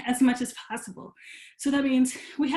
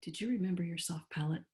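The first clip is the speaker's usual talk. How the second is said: ordinary talk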